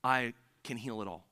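soft and slow, each word laid down gently; gentle Jesus quote. Retold or imitated. Pastor voicing Jesus to the congregation, soft and tender. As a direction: speak it like a tender blessing. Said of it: "Jesus is saying".

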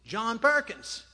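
loud, slow, pointed question; jailer's challenge. Retold or imitated. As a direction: call out a prisoner by name, loudly. Jailer calling out prisoner in story.

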